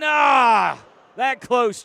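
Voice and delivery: shouted near fall; loud. Real, not acted. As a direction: yell it out.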